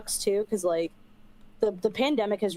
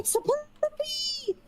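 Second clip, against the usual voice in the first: puppy talk; slower than usual. Real, not acted. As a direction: coo at the dog, high and drawn out. Greeting her dog who walks in.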